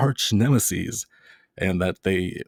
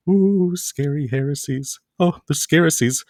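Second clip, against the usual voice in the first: mock spooky; 6 semitones higher than usual. Lecturer teasing students with a mock-scared ooh. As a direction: ham up the fear of heresies like a ghost story.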